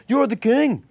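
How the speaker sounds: high, loud put-on voice of an adoring stranger; high, loud impression of a drunk Dutch fan hailing a celebrity; imitating a fan. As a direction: gush it like a drunk starstruck fan. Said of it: "And he goes".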